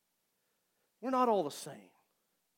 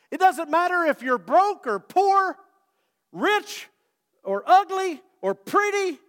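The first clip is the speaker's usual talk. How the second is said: louder, higher preaching build; full-force preaching; 9 semitones higher, 9 dB louder than usual. Acted. Preacher urging the church that God looks at the heart, fervent. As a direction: drive the point home to the congregation.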